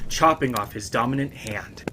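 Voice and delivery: plain reading voice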